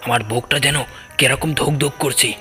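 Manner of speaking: usual speaking voice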